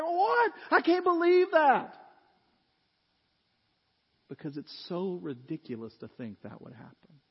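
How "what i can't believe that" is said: shocked disbelief. Retold or imitated. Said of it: "everybody's like".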